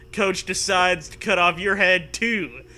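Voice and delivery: normal talking voice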